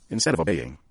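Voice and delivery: usual speaking voice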